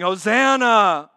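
preacher voicing the crowd's shout. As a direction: call it like the crowd. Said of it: "they're shouting".

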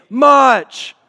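ringing shout of much; shouted response word. Shown as a demonstration. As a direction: belt out much for the crowd to echo. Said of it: "everybody say".

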